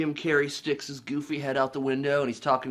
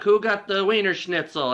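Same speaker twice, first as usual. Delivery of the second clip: raised-pitch vendor-like call; imitated calling out; 6 semitones higher, 4 dB louder than usual. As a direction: call out an order to the dogs. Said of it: "calling out the dogs".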